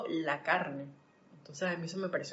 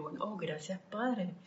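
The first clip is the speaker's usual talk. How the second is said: softer, murmured thank-you to God; 8 dB quieter than usual. Retold or imitated. Quietly thanking God after hearing she can arrive later. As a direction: breathe a quiet grateful prayer.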